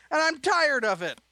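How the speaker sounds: high, loud furious shout; angry shout. Acted. Son shouts at father while packing. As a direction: yell it in anger. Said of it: "Jacob shouted in anger".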